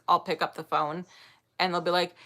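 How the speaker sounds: ordinary talk, nothing marked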